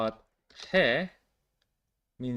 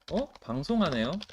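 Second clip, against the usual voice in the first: surprised tone; 6 semitones higher, much faster than usual. Shown as a demonstration. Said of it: "makes it this tone of".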